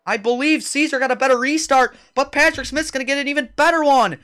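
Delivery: high, loud race commentary; live play-by-play. Real, not acted. Announcer calls three-lap shootout to viewers, excited play-by-play. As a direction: call the restart with driving urgency.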